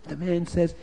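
plain reading voice

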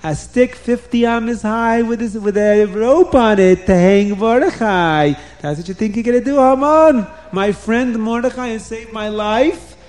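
storybook king building in indignation; outraged king. A narrator's reading. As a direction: confront the plotter in royal outrage. King outraged at plot against friend.

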